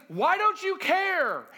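high, loud, slow pleading cry; desperate plea. Acted. Disciples crying out to sleeping Jesus in fear. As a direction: drag it out as a hurt, frightened accusation. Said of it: "Mark, it says".

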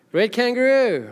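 usual speaking voice

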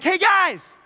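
high and loud, like a preacher at a rally; rally crowd call. Pretend. Abraham at his rally, shouting high and loud. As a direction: pump up the crowd on big screens. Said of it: "And he's like".

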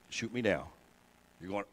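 ordinary delivery

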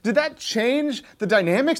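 ordinary talk

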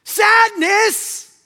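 high, frustrated shout; Joy. Acted. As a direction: shriek the name in exasperation. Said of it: "Joy just gets frustrated".

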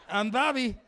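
bold, booming self-introduction; brash introduction. Acted. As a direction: blare your name at strangers. Brash introduction to terrified strangers.

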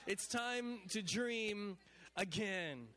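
drawn-out preacher's line; slow and deliberate. Shown as a demonstration. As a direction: spell out each word slowly. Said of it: "Look at your neighbor and say".

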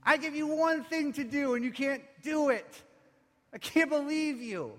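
high, loud voice of outraged reproach; scolding synagogue ruler. Acted. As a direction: berate them as an oppressive ruler. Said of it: "Does he sound like the synagogue ruler?".

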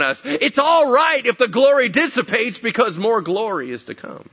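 high, loud, insistent preacher; full-force preaching. Real, not acted. Preacher challenging those stuck in past glory, full-force preaching. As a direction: preach it out in rising indignation.

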